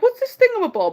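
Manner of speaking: high, wide-eyed mock mermaid voice; mock Ariel. Mocking Ariel finding a human object, high wondering voice. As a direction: play her as a naive, curious princess. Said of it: "When she's like".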